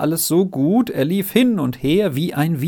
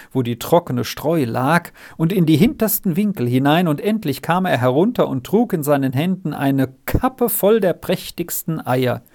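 ordinary delivery, nothing marked

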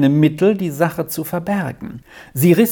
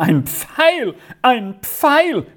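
high, repeated cry of dismay; wailing exclamation; 10 semitones higher, slower than usual. A narrator's reading. Aunt wrings her hands over the arrow, crying out. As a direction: cry it out higher the second time. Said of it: "rief sie einmal ums andere".

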